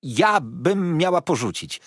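slow, loud "Ja bym miała porzucić" in disbelief; a loyal maid's indignant outcry; indignant cry. A narrator's reading. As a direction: cry out, offended at the very idea. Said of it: "wołała poczciwa Peggotty".

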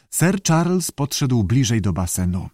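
ordinary delivery

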